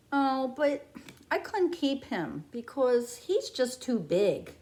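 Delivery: ordinary delivery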